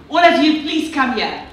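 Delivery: ordinary delivery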